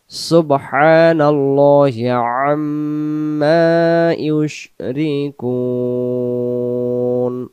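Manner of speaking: slow chanted tajweed, lam said full; Quran recitation. Shown as a demonstration. Teacher showing how to read Allah here, slow chant. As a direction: recite it slowly and melodically. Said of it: "সুবহানাল্লাহ এমন করে পড়ব".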